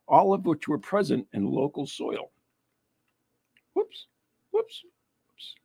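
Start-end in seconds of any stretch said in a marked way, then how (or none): 3.7-5.5 high whoops. Real, not acted. Repeated high whoops at scrolling.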